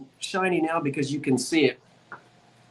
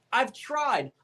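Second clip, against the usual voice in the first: usual speaking voice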